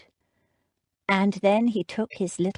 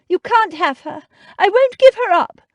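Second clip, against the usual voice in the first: much higher, louder desperate cry; a mother clutching her child, pleading loudly; frantic refusal; 12 semitones higher, 11 dB louder than usual. Acted. Mother refuses to give up daughter.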